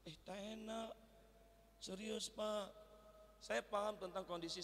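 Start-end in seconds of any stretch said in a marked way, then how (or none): none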